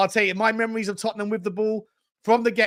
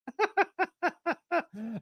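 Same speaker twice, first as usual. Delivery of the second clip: soft laugh; 8 semitones higher, 7 dB quieter than usual. Real, not acted. Laughs at the chat.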